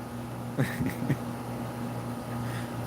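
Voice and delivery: high airy giggle, no voice; real laugh at the joke; wheezing laugh; breathy laughter. Real, not acted. Laughing again after rereading.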